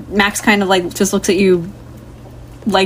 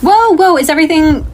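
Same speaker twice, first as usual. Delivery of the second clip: high, startled check-in; alarmed interjection; 7 semitones higher, 3 dB louder than usual. Acted. Carol coming in on the commotion, alarmed. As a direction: burst in with pitch jumping up.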